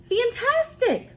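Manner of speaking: high, slow, amazed cry; excited exclaim. A narrator's reading. Teacher voicing Hoppy marveling at the painting, amazed. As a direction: gasp it out in wonder. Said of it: "Hoppy exclaimed".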